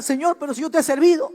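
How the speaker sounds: a youth shouting accusations at God; complaining to God. Retold or imitated. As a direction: protest to God in anguish. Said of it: "Y cuestionamos a Dios".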